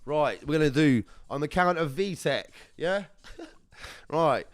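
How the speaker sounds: normal talking voice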